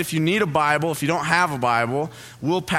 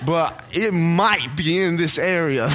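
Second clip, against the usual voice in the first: drawn-out, pointed mock hint; hammed-up coy non-answer, stretching the hint; hinting denial. Student subtly narking out his friend, exaggerated hinting.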